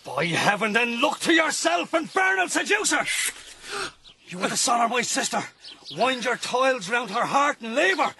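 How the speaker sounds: outraged brother. Acted. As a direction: threaten him, building to a shout. Brother rages at seducer.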